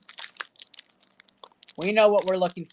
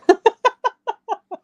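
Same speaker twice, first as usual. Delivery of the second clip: sudden high laugh, louder; high burst of laughter; 9 dB louder than usual. Real, not acted. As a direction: laugh out loud, high and bright. Laughs at Dia de los Muertos joke.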